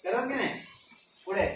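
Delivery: ordinary talk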